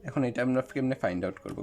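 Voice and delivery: usual speaking voice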